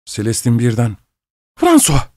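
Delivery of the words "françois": very high-pitched startled cry of a name; shocked outcry, pitch leaping up; sudden cry. A narrator's reading. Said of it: "diye haykırdı".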